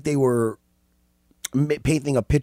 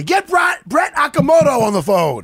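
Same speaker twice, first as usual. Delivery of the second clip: calling out; 11 semitones higher, 5 dB louder than usual. Real, not acted. Calling out orders on air.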